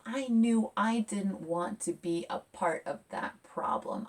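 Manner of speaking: ordinary delivery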